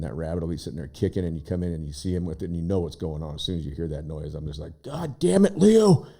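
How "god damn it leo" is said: yelling at the cat. Retold or imitated. Yelling at cat eating rabbit.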